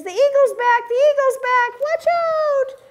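high barking alarm; alarm warning. Acted. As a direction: warn everyone to run. Big Bark warns of the eagle.

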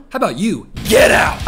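loud, high shout of outrage; furious shout. Real, not acted. Yelling at the contestant on screen, furious shout. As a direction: yell it at the screen. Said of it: "I'm furious right now".

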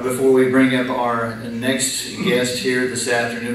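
ordinary talk

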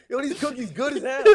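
high, loud hammed-up praise; mock Subway crasher praise. Friend mimics the cookie thrower shouting praise, high and loud. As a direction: holler it while throwing cookies. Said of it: "The dude that would go in Subway and be like".

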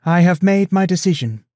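high, loud public declaration; projected, formal proclamation; addressing the room. A narrator's reading. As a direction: announce it to the whole hall. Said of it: "she told the room".

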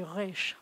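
"resh" opening on a guttural r; throaty Israeli r on "resh"; Israeli back R. Shown as a demonstration. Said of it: "way back here".

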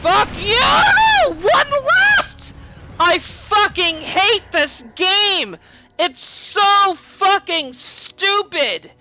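high raging yell, near crying; furious shout. Real, not acted. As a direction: scream in real frustration. Rage, shouting.

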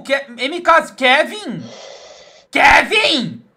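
loud, high shout; calling out. Real, not acted. Streamer calling a viewer in chat, loud shout. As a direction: call a friend across the room.

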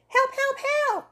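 high panicked cries for help; shouting for help. Acted. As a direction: squeal help help help like a terrified girl. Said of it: "she runs off shouting".

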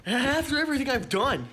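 loud, high, bitter yell; furious outburst at the boss; angry shout. Acted. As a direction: throw it at his face. Fired employee yelling at boss.

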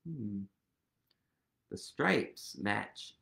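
ordinary delivery, nothing marked

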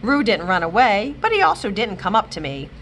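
ordinary delivery, nothing marked

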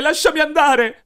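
frantic pleading scream. Acted. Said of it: "io urlai".

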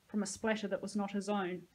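ordinary delivery, nothing marked

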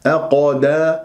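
أقدا with light أ, dark bouncing ق; thin hamza, then heavy qaf echo; heavy qalqala. Shown as a demonstration. Teacher modelling the correct start, heavy qalqala. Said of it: "فتفخيم قلقلة".

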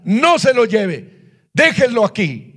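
firm order, slowed on the first words; commanding authority. Retold or imitated. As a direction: lay down the command with spiritual authority. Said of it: "le dije así con la autoridad del Señor".

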